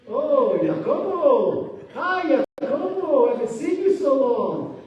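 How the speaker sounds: loud, high, drawn-out fawning greeting; gushing relatives. Retold or imitated. Relatives greeting the new millionaire, gushing and loud. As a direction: sing out his name like long-lost family.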